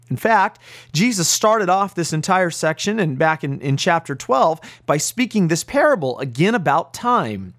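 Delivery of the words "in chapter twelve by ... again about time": normal talking voice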